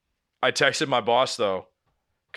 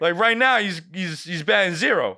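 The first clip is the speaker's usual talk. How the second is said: exasperated; 7 semitones higher, 4 dB louder than usual. Retold or imitated. Venting about the doctor.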